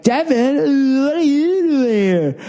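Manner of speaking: high, drawn-out Valley drawl; Californians sketch voice. Retold or imitated. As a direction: ham up the SNL Californians. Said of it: "The Californians".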